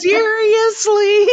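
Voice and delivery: high, loud, drawn-out disbelief; mock incredulity. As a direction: stretch it out in exaggerated exasperation. Repeats the mock exasperated reaction.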